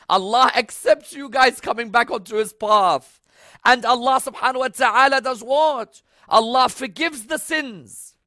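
high, loud, slow proclamation; impassioned, ringing sermon delivery; full-force preaching. Real, not acted. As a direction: proclaim it to the whole congregation, words drawn out.

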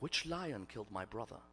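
very soft, low, retold grief; a bereaved sister's hushed question; subdued grief. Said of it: "She began to cry".

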